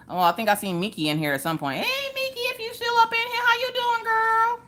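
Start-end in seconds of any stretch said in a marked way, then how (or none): 1.8-4.6 much higher, sing-song hello to a viewer; sweet falsetto welcome, stretched out; high falsetto greeting. Real, not acted.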